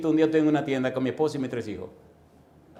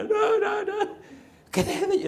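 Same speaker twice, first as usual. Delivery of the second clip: very high comic wail; retold yelling; slower than usual. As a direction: squeal it in mock desperation, half laughing. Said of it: "el tipo empieza a gritar". (chillido cómico imitando al que grita)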